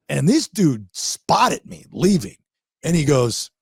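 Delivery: ordinary delivery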